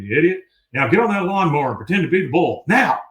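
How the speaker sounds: a drill sergeant yelling commands; shouting orders. Retold or imitated. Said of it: "he's just shouting orders at him".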